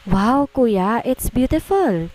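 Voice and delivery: put-on delighted child, voice climbing high; overjoyed. A narrator's reading. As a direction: gasp of joy at the doll, slow on the last words. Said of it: "Tuwang-tuwang sabi ni Stephanie".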